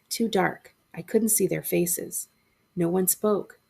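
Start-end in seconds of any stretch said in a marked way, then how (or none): none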